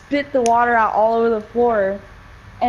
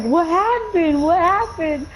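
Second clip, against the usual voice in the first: mom's voice, higher and slow; concerned mom; 7 semitones higher, slower than usual. Retold or imitated. Mom seeing her daughter spit, asking higher. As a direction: play mom asking anxiously. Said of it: "my mom was like".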